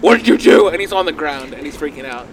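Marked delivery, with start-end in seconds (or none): 0.0-0.7 loud, frantic shouting; panicked yell. Acted. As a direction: yell in panic at the unseen biter. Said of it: "He's like".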